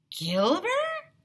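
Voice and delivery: high questioning; puzzled. A narrator's reading. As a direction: puzzle over the word. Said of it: "asked Ryan".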